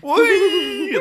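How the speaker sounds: high delighted whee; excited squeal. Real, not acted. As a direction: squeal with glee on the ride. Spinning on a ride.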